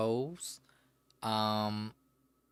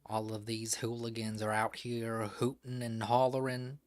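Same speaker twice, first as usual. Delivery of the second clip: put-on Southern accent. Streamer mocks fandom drama for chat in a hammed Southern accent. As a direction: play a fed-up Southern old-timer in a Southern accent. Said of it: "Love me some Southern accent".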